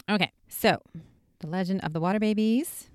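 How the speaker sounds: ordinary talk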